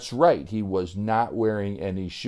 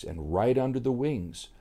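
usual speaking voice

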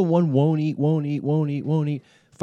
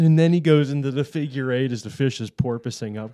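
ordinary delivery

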